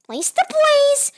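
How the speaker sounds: high, slow yell of "place the blaze"; frustrated yell. Real, not acted. Repeats phrase, then shouts it.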